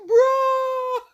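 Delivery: high, loud drawn-out wail; mock exasperated shout. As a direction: cry out in mock despair. How one reacts to guy.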